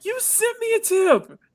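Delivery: very high, slow squeak; stunned amazement. Real, not acted. Streamer to the tipper, amazed high squeak.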